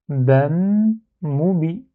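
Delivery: "Bam" and "moubi" said apart, a pause between; two separate syllables. Shown as a demonstration. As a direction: finish "Bam", stop, then say "moubi". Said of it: "j'enlève les deux syllabes".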